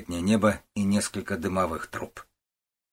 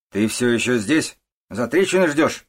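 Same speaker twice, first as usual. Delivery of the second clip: louder, higher, exasperated; angry scolding; 4 semitones higher, 6 dB louder than usual. A narrator's reading. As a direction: scold a daughter who is still dawdling. Mother scolding daughter for lingering. (сердитый нагоняй)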